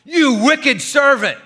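loud, slow, high roar; the king roaring at his servant; roaring rebuke. Acted. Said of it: "I'm gonna have to say this really loud".

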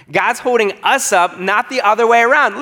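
insistent, ringing restatement; emphatic repeat. Real, not acted.

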